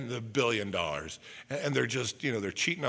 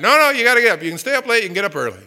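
dad loudly insisting; 9 semitones higher, 13 dB louder, faster than usual. Retold or imitated.

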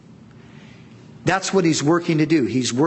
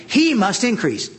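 "He" punched much higher; stress on he; 8 semitones higher than usual. Shown as a demonstration. Preacher driving home the point, "He" stressed high. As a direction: lift "He" high, then fall on "must increase". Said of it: "that's the emphasis needs to be on him".

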